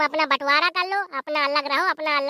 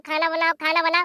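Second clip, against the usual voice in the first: normal talking voice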